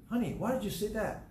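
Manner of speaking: imitating wife. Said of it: "but she'll say".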